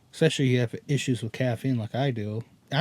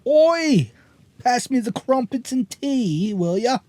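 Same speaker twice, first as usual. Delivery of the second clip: loud, high, hammed-up British; British accent veering toward Irish or Scottish; British accent attempt; 9 semitones higher, 4 dB louder, slower than usual. Shown as a demonstration. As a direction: call out like a cartoon Brit. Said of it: "Let me hear your British accent".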